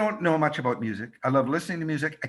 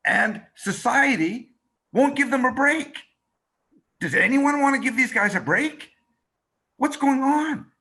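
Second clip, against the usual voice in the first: rising, louder outrage, slowing down; outraged; 9 semitones higher, 4 dB louder than usual. Retold or imitated. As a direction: push it up like a crusading reporter. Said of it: "And I go".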